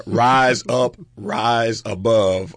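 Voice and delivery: amused, loud and stretched slogan; drawn-out slogan. Shown as a demonstration. Host repeating the mug's slogan to John, drawn out. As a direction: draw out each word, a chuckle breaking in.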